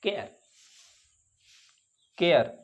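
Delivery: केयर gliding e into uh; air sound. Shown as a demonstration. Teacher modelling the air vowel. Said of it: "ए और आ की आवाज़".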